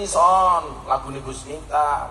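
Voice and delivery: usual speaking voice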